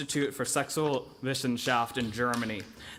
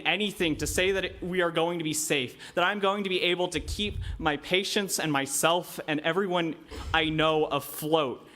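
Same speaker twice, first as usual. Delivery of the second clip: ordinary delivery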